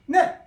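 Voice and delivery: 네 barked short, dropping from high; 네 falling straight down like a drop; short sharp falling ne. Shown as a demonstration. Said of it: "위에서 뭐가 떨어지는 게".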